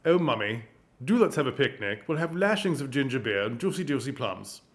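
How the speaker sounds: hammed-up upper-class English lead-in, lightly voiced; a light silly posh English accent, not far from her own. Shown as a demonstration. Teacher demonstrates a posh English lead-in: light silly English accent. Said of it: "my lead-in to an English posh accent that's silly".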